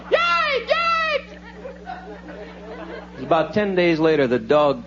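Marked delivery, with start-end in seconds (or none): 0.1-3.2 high yelping of a hurt dog; imitated dog yelps. Dog shot in the foot, imitated pained yelps. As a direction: yelp like a dog hit in the paw.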